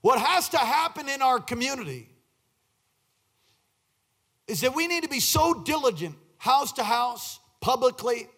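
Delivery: high, insistent pulpit exhortation; forceful preaching. Real, not acted. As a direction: build a rhythmic list for the congregation.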